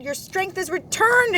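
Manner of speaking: high excited exclamation; exclaimed. Acted. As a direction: cheer his recovery with delight. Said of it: "exclaimed Mouse".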